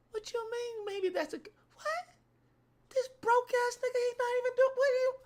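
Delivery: very high squeaky disbelief; outraged girlfriend. Acted. Girlfriend rejecting her friend's broke man, outraged.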